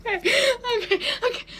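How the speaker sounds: trying to calm down through tears of laughter; crying with laughter. Real, not acted. Teen recovering from laughing fit, laugh-crying. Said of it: "I'm crying".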